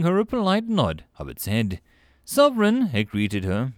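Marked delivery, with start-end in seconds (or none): none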